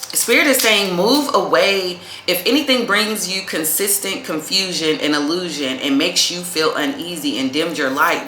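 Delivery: normal talking voice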